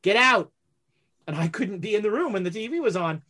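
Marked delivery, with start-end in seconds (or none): 0.0-0.5 louder, gruff dad shooing him out; grumpy father. Retold or imitated. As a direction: bark it like an annoyed dad. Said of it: "And so he would always go".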